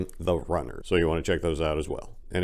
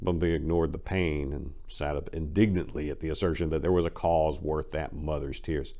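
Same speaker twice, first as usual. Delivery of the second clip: ordinary delivery, nothing marked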